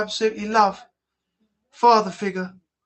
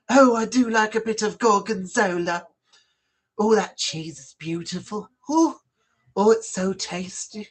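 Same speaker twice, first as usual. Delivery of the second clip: camp voice. Retold or imitated. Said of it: "like a homosexual or something".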